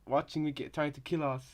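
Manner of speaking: normal talking voice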